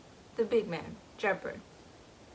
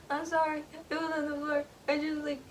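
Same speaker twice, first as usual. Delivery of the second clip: put-on timid boy apology; 8 semitones higher, faster than usual. Gus apologizing to his dad.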